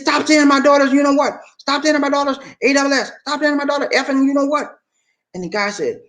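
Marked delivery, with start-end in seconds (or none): 0.0-4.8 retold angry mom. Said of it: "the mom was pissed off".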